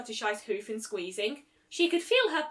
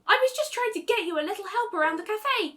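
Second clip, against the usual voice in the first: higher, louder protest; 10 semitones higher, 5 dB louder than usual. A narrator's reading. Twilight defending herself to Applejack, protesting. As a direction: defend yourself, indignant. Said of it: "Twilight protested".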